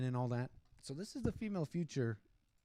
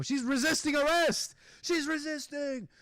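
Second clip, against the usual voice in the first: high-pitched hammed-up cry; mock screaming; 11 semitones higher, 5 dB louder than usual. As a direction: shriek it out in mockery. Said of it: "She's screaming".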